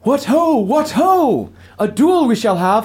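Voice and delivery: put-on high, loud, booming old duelist; hearty elven father. Ghost father greeting his son before a duel, hearty and loud. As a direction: greet your son with a jolly challenge.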